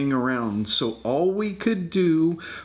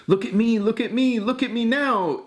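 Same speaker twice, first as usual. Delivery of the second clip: show-off cat; 7 semitones higher than usual. A narrator's reading. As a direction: crow it like a proud show-off. Cat showing off.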